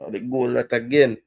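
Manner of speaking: ordinary delivery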